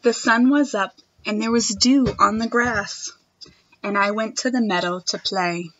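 put-on expressive read-aloud for children; reading a picture book to a class; storybook reading. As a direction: read it like story time for little kids.

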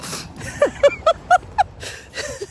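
airy whispered laughing; breathless laugh. Real, not acted. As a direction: wheeze out a laugh. Laughs at herself.

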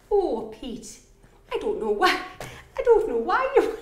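imitating his mum's north-east accent; attempted Middlesbrough mum, pitch climbing. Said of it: "I try and be your mum".

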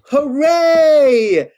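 high, loud joyful cheer; cheering hooray. Acted. Author cheers the sweet ending for the kids, loud joyful cheer. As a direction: cheer it out big.

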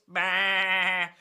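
loud sheep baa; sheep bleating. Acted. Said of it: "mooing and baaing and clucking".

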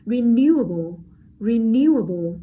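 renewable with NEW punched loud; light re, big stretched NEW in renewable; stress on second syllable. Shown as a demonstration. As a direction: hit NEW hard in renewable. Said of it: "trọng âm rơi vào âm tiết số hai".

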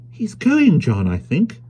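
put-on high, worried young wife; a wife fretting over the old man; light high voice, a touch uneasy; young wife's voice. Wife whispering worry to husband.

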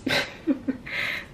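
breathy little laugh after a slip; laughing at own slip. Real, not acted. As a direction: laugh off your own blunder.

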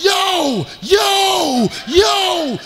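high, loud "yo" shouts; loud high frantic calls; panicked yell. Retold or imitated. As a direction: yell like the Lone Ranger at full gallop. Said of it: "I'm like".

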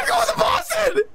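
high, jubilant squeal; excited shout. Real, not acted. Telling friend about the Boston trip, excited shout. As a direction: shout the news overjoyed.

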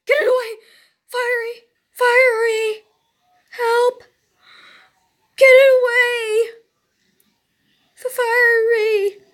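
high frantic calls for help; panicked yelling. Real, not acted. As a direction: cry out in panic.

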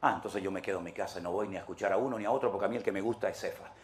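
low, soft, sniffy church gossip; hushed picky congregant; gossiping churchgoer. Retold or imitated. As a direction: dismiss the preacher with a pout.

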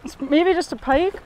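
usual speaking voice

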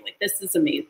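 ordinary delivery

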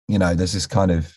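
usual speaking voice

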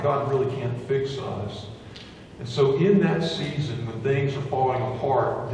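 normal talking voice

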